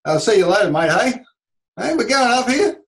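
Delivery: Australian upward lilt; Aussie rising tune. Retold or imitated. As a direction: let every phrase go up like a laid-back Aussie. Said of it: "our voice goes up naturally".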